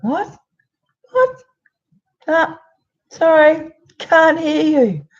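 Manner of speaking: teasing the ex, pitched high and loud; mock can't hear you. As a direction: pretend you can't hear him, playfully dismissive. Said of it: "And I'm like".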